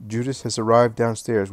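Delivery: ordinary delivery, nothing marked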